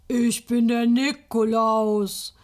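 slow, loud mock Nikolaus call; hammed-up child's Saint Nicholas voice; child playing Nikolaus. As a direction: boom it out like a pretend Nikolaus. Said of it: "ruft es mit tiefer Stimme".

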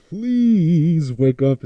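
loud, drawn-out plea; loud pleading. Acted. Begging Mister West to wake up, loud and drawn-out. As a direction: burst out, stretching the first word slowly.

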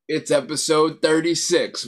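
normal talking voice

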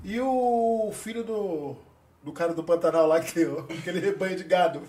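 ordinary talk, nothing marked

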